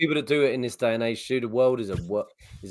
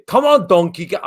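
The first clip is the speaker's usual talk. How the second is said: raised, louder attempt at a Russian accent; 5 semitones higher, 6 dB louder than usual. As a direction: play a Russian guard, accent shaky on purpose. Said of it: "where we were Russian guards".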